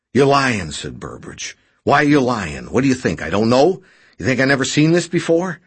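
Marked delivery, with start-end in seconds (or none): 0.1-0.7 high, loud character voice; agitated accusation. A narrator's reading. Injured man catching his rescuer in a lie, agitated. 1.8-5.6 high, loud, rising challenge; agitated accusation. A narrator's reading. Injured man challenging his lying rescuer, agitated.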